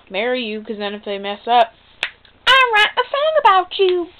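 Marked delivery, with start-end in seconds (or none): 2.5-4.1 much higher, louder mock girly voice. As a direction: sneer at her by aping her. Mocking [name]'s voice.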